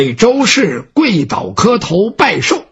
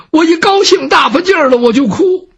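high, mock-tearful old woman's excuse; 7 semitones higher than usual. A narrator's reading. Mother-in-law explaining her tears at the birthday, high and tearful. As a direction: insist it's joy through a touch of tears. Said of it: "哭了？".

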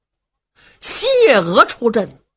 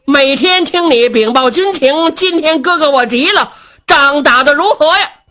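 pitched up, pressing and impatient; impatient older brother; 7 semitones higher, 4 dB louder than usual. A narrator's reading. Brother demands his sister's battle report, impatient high voice. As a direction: demand news in a high, insistent voice.

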